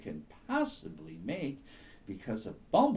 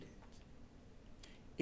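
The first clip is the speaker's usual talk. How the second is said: soft, very slow hush; 12 dB quieter than usual. A narrator's reading. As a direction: breathe it out quietly and slowly. Rabbit makes tiny noise.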